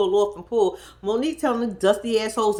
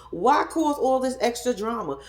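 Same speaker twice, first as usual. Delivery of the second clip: ordinary talk, nothing marked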